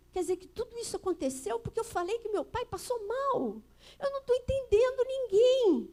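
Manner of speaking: put-on a bewildered girl starting to cry; retelling her childhood outburst, in a high, tearful voice; crying child. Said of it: "E eu comecei a chorar".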